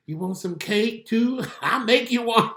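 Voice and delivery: very high, slow, with a laugh; spoiling his daughter with cake; doting daddy. Retold or imitated. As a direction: giggle as you spoil her. Dad voicing his doting reply.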